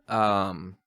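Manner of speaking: usual speaking voice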